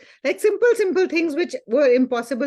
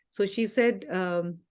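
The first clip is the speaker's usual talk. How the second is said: normal talking voice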